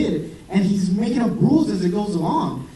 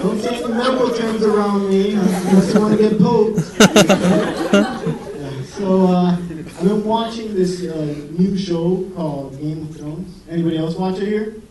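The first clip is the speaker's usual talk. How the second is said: usual speaking voice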